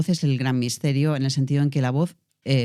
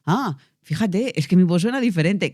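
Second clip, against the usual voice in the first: mock amazed student; 6 semitones higher than usual. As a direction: act out the pupil's surprise at her new sound. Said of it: "es como".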